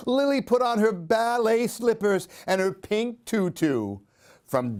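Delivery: lively, sing-song storybook reading; animated picture-book read-aloud; reading to children. A narrator's reading. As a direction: read it like story time for little ones.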